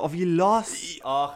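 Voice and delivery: loud, high groan of dismay. Real, not acted. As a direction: moan over losing. Just lost the quiz.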